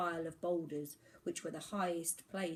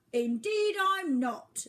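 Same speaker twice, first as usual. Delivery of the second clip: very high, loud, slow haughty reply; sniffy; 12 semitones higher, 10 dB louder, slower than usual. Acted. Albatross sniffily correcting the boy, high slow voice. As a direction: sniff with offended dignity. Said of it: "the bird sniffed".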